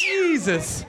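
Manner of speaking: high, startled exclamation; taken aback. Real, not acted. Host reacting to a shrieking audience member, high and startled. Startled by crowd's loud whooping.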